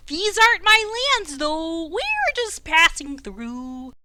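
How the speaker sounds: high, proud croaking king voice; warbly frog king. Acted. Frog king chats with the passing warriors, high and warbly. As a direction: play the pompous frog king. GM holds the king's voice.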